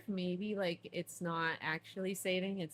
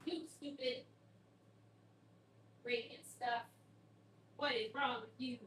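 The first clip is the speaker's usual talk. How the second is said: scolding cats; 5 semitones higher, 5 dB quieter than usual. Real, not acted. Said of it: "while I yell at my cats".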